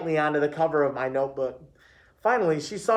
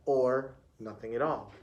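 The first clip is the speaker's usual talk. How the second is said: lower, softer echo; teacher repeating; 4 semitones lower, 7 dB quieter than usual. A narrator's reading. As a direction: repeat it back low and quietly. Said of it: "repeated Miss Pointy".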